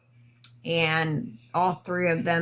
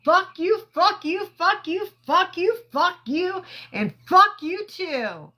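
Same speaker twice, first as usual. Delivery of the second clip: imitated defiant shouting; 11 semitones higher than usual. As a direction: fire each one off at a different person. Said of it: "He was like".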